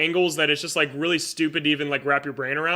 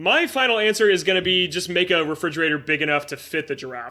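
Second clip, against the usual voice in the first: ordinary delivery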